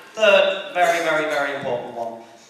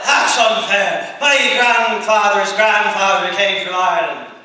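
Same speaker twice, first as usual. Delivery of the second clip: loud, higher, affronted whine; mock indignant protest; 4 semitones higher, 8 dB louder, slower than usual. Wannabe Irishman defending his ancestry to worker, mock indignant. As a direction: protest loudly as the offended wannabe.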